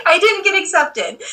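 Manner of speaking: high voice full of laughter; amused, laugh-filled self-mockery; laughing admission. Real, not acted. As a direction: admit it through real giggles. Repeats rejection while laughing at herself.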